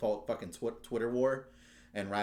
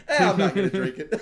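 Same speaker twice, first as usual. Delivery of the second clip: can't hold the quote together for laughing; 10 semitones higher, 13 dB louder than usual. Real, not acted. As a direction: quote squeezed out through laughter, higher and louder. Quoting ad while laughing.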